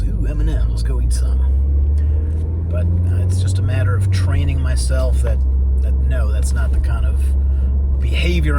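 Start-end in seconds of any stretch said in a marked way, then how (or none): 0.0-2.7 a hint of a greedy inner voice; slow, low tempted drawl on the last words. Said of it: "my brain goes".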